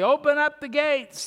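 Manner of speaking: loud high call to open the gates; a herald's cry at the city gate. Acted. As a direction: shout it up to the gatekeepers. Crowd calling at city gates.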